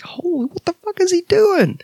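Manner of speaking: high, stunned outburst; shocked disbelief. Retold or imitated. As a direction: gasp it out in disbelief. Shocked reaction to a riff.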